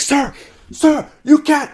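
high, loud calling after someone; acted alarmed shout. Gate staff to a passenger jumping the gate, alarmed shout. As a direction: imitate staff yelling to stop a runner.

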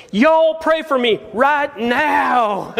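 put-on high, drawn-out Southern little boy; Southern accent. Little boy in trouble calling to the church, Southern accent. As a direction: plead slowly in a hammed-up Southern accent. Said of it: "the little guy in a southern accent says".

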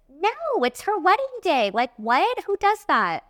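who would even do that, high and appalled; indignant disbelief. Real, not acted. Artist venting to listeners about the bride, indignant and high. Outraged at not being tipped.

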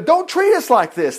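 high-pitched mock plea; hammed-up begging; acted begging. A narrator's reading. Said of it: "they begged".

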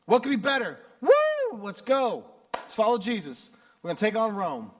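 rally the troops, all hype; eager crowd. Retold or imitated. The crowd cheers Jesus into the city, pumped up and excited.

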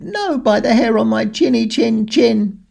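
defiant little pig squeak; squeaky pig. A narrator's reading. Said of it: "And Bertie said".

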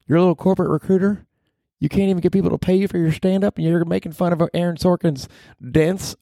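very high falsetto nagging; scolding mom figure. Acted. Mom figure telling off Danny for mocking Aaron Sorkin, shrill-high scolding. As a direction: scold in a squeaky high put-on voice.

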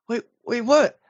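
high-pitched, mock puzzled kid; confused child. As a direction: imitate a little boy lightly baffled. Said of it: "confused 'cause he's thinking it's a bad thing".

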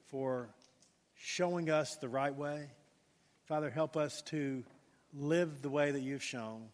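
slow, hushed prayer with long pauses. Real, not acted. Pastor leads congregation in closing prayer, slow and reverent. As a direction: pray unhurriedly, letting each phrase settle and softening toward the amen.

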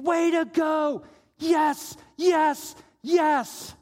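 loud, high shouts of applause; imitating a roaring, encouraging crowd; cheering. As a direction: cheer them on at full voice, each yes punched. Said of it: "and cheering them, saying".

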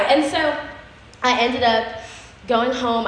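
normal talking voice

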